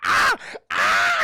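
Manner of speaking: loud, very high "ah" screams; mock screaming. Imitating Parker as Ash grabs him, mock screaming.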